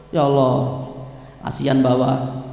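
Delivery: low, soft mock prayer; child's resigned prayer. Child praying for bedridden father's quick death, low resigned prayer. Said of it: "Coba doanya anak kalau bapaknya sakit".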